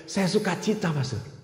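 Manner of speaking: soft grateful woman. Retold or imitated. Grateful woman speaking to the priest.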